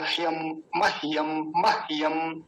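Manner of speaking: मह्यम as "mah-yam", no vowel after h; मह्यम in two syllables, "mah-yam"; half h joined quickly. Shown as a demonstration. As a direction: run h straight into "yam" in ह्यम and मह्यम. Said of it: "तो जल्दी बोला जाएगा".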